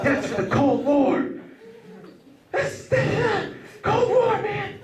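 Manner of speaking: high, loud, stretched-out yell; triumphant yell. Retold or imitated. Comedian yells at the man in the food court, stretched triumphant yell. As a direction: crow it at the beaten guy. Yelling it while kicking.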